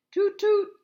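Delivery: very high, loud whistle toot; train whistle. A narrator's reading. Gordon the engine whistles as he leaves, high and loud. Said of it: "Gordon whistled".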